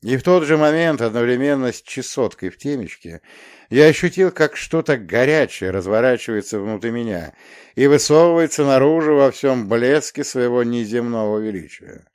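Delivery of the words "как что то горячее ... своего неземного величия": ordinary delivery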